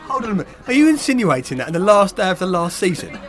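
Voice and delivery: hammed-up indignant outrage; shaking with anger. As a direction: play it as mock offended fury. Mock outrage at accusation.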